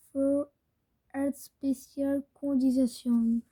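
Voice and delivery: slow, halting English reading in a heavy French accent; mauvais accent anglais. Real, not acted. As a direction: sound out unfamiliar English word by word, with a French accent. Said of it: "mais si j'ai un mauvais accent anglais". (lecture lente en anglais avec un fort accent français)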